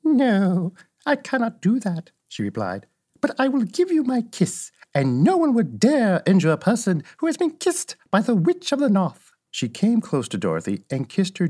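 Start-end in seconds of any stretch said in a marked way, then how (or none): 0.0-2.1 put-on high-pitched old witch voice; frank old witch. Old witch answering Dorothy about the wizard, high witch voice. 3.2-9.3 high-pitched good witch voice; protective old witch. Acted. Old witch giving Dorothy her kiss, high protective witch voice.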